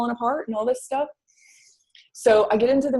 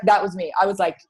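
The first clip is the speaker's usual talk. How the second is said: ordinary talk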